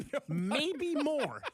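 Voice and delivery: laughing. Real, not acted. Laughing at misprint.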